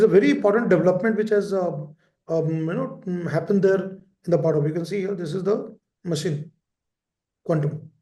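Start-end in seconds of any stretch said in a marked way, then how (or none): none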